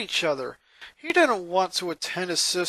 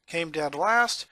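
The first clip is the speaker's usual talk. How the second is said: plain reading voice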